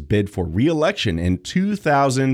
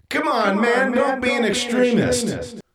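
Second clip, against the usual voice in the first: mock president; 13 semitones higher than usual. Acting out the president's reply.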